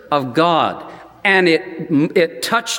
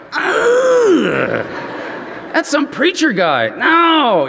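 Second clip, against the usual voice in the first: put-on much higher, groaning kid protest; whiny kids; 9 semitones higher, much slower than usual. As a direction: whine it like a bored boy refusing. Bored boys refuse the movie.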